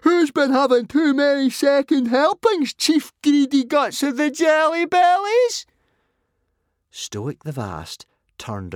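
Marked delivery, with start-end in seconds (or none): 0.0-5.7 shrill, very high, loud jeering; screamed insults. A narrator's reading. Fishlegs mocking his greedy chief, screaming. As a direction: screech the taunt at the top of your voice. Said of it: "to scream some more insults".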